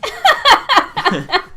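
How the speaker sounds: high laughing fit. Real, not acted. Police take his phone.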